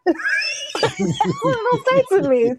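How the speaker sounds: ordinary delivery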